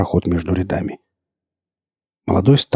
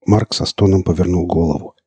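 plain reading voice

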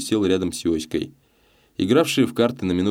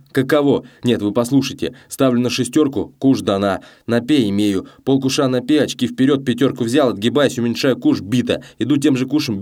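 baron lamenting bad luck, raised pitch; agitated losing gambler; 5 semitones higher, 4 dB louder than usual. A narrator's reading. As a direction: pour out your losing streak excitedly.

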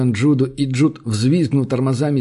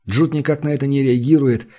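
ordinary delivery, nothing marked

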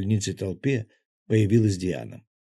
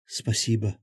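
ordinary delivery, nothing marked

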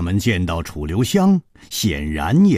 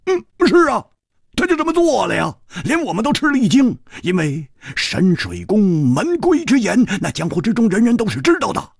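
higher, brisk swordsman recounting the interrogation; Hu Tiehua's voice; 13 semitones higher, 4 dB louder than usual. Acted. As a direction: tell it lively with rising indignation.

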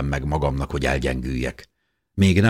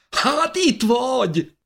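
much higher, bursting out with relief; relieved outburst; slower than usual. Acted. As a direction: blurt it out, finally finding her. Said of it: "tört ki belőlem". (megkönnyebbült, magas hangú felkiáltás)

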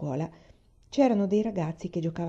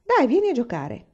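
higher, louder call to join in; eager invitation; 6 semitones higher, 5 dB louder than usual. A narrator's reading. Boy invites Laura into the ball game, eagerly calling. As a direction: urge them to come along, voice lifted.

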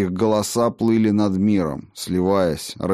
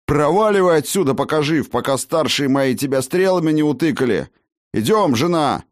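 raised, high-pitched furious tirade; imperious anger; 7 semitones higher, 4 dB louder than usual. A narrator's reading. Master driving the healer away from his wife, imperious and angry. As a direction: order them out with angry authority. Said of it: "властно бросил он".

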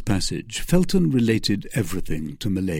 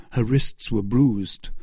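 usual speaking voice

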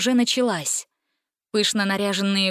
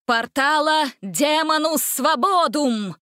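slow, high spell cry; drawn-out villain incantation; 6 semitones higher, slower than usual. A narrator's reading. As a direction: cast the dark spell. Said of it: "сотворить заклятие".

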